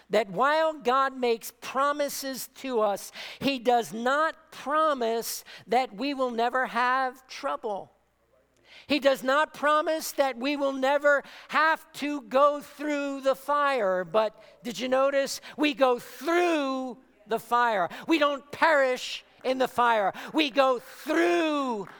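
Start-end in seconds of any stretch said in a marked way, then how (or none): 3.9-15.4 rousing, emphatic preacher's build; preaching at full force. Acted. Preacher encouraging believers through trials, preaching at full force. 15.6-17.9 "through" stretched long and loud; stress on "through". Real, not acted. Preacher assuring believers they survive trials, stress on "through".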